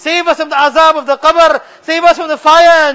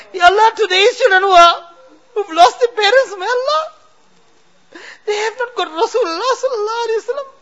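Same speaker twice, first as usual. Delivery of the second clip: weeping dua; 3 semitones higher than usual. Real, not acted. Imam weeping as he prays for today's orphans. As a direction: pray through sobs, voice breaking.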